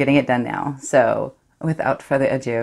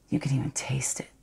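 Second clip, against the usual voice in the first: whisper; 6 dB quieter than usual. Acted. Savouring secret.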